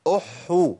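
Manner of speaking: "أحو" with a held h into long oo; teacher's drawn-out "أحو"; doubled ha with damma. Shown as a demonstration. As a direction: lean on the h in "أحو". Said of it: "التشديد بالضم".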